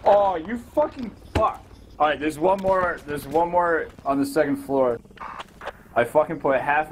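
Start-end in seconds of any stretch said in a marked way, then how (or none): 0.0-1.6 sudden high angry curse; frustrated outburst. Real, not acted. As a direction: swear at being killed. Killed, swearing in frustration.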